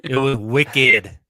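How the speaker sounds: hammed-up, drawn-out Boston accent on "wicked". Cohost mimicking a team executive's quote, poor Boston accent. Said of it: "Did he say wicked in the Boston accent?".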